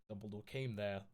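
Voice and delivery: plain reading voice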